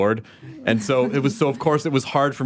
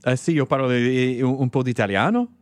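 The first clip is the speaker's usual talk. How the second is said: speaking Italian. Shown as a demonstration. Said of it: "Can you speak another language?".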